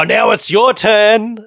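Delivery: high, slow mock-showman voice handing the song over. Host imitating a singer pointing the mic at the crowd, high and slow.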